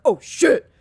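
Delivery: low, loud grunting curse; deep old man grunt. Retold or imitated. Jump-scared by a clown at the door, deep loud grunt. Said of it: "the deepest voice I possibly could do".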